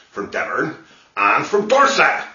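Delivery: ordinary delivery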